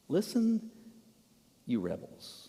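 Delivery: hushed, mild address with long pauses; mock gentle. Shown as a demonstration. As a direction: say it far too softly for a rebuke. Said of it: "in terms of tone, what do you think".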